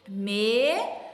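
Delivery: slow, split Melão into me-lão; syllable by syllable. Shown as a demonstration. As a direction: stretch out Melão, one beat per syllable. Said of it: "Falar devagarzinho e ir contando".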